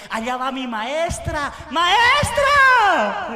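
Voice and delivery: put-on high, loud child's call to the teacher. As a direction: shout like an excited little kid.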